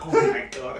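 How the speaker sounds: relieved surprise. Retold or imitated. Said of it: "I was like".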